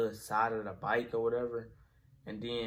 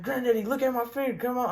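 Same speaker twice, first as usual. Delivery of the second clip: high, pleading mock cry; retold panicky plea, pitched high; panicked pleading; 11 semitones higher than usual. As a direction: beg like a hurt kid, retold with a grin. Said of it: "I'm screaming. I'm crying".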